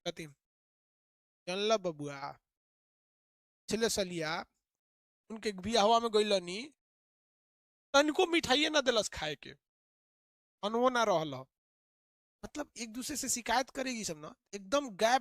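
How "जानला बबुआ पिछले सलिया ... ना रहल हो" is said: a hint of a gossiping aunty, pausing between phrases; village woman. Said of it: "दो second का gap रख देती है".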